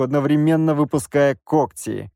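ordinary delivery

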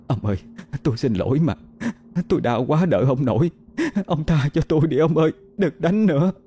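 a high, pleading wife begging through tears; tearful begging. A narrator's reading. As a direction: plead with him, rising and quickening toward the end. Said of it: "Bà vừa khóc vừa van xin". (vừa khóc vừa van xin, giọng cao dần)

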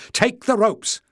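high loud call. A narrator's reading. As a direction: call out across the water. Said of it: "Leif called".

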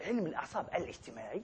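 musing half-voice. Shown as a demonstration. Repeats term, then drops low.

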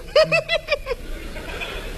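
尖声的得意大笑; 高声哈哈幸灾乐祸; 幸灾乐祸地笑. Acted. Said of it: "我在旁边乐". (看别人分手时的得意笑声)

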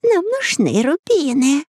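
high, slow shrill cry; shouting. A narrator's reading. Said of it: "закричала ящерица".

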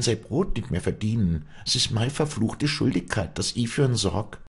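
high, emphatic dialect voice; impassioned peasant woman explaining herself; Walli, resolute. Acted. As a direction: insist firmly on your duty. Walli explains her decision to priest.

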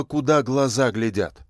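usual speaking voice

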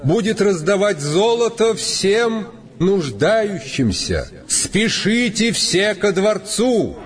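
high, slow proclamation to crowd; town crier. A narrator's reading. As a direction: call it out like a herald. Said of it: "глашатые объявили королевский указ".

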